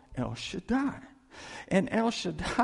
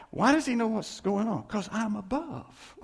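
ordinary talk

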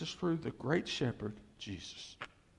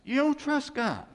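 much higher and louder, stress on don't; emphatic rebuke; 12 semitones higher, 7 dB louder than usual. Real, not acted. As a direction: call them out hard on don't. Preacher rebuking the congregation.

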